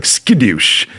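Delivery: a light Kung Fu Panda catchphrase. Retold or imitated. Host quoting the panda's catchphrase, lightly voiced. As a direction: toss it off like the panda.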